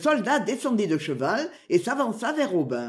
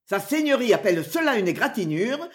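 usual speaking voice

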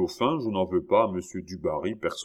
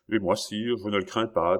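plain reading voice

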